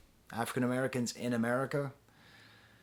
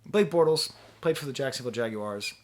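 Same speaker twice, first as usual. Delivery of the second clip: usual speaking voice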